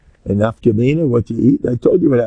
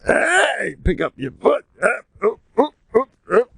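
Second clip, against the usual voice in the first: calling out; 11 semitones higher than usual. Retold or imitated. Urging friends through thorns.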